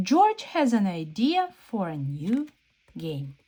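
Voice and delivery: ordinary delivery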